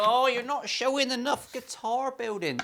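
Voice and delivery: high-pitched put-on whine; mocking, high complaining voice; whiny commenter. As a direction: whinge like a nitpicking commenter. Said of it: "when I read the whiny comments".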